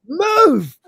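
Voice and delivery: sharp high shout of Move. Retold or imitated. Lister snapping at Cat to move. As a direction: bark Move! loud and high. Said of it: "he hasn't shouted yet".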